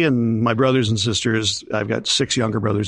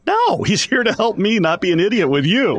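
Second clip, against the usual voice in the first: high-pitched, protesting reassurance; retold emphatic denial; 10 semitones higher than usual. Promoter reassures worried tour accountant, retold emphatic protest. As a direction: imitate yourself hastily setting someone straight.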